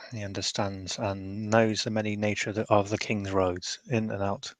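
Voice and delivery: ordinary delivery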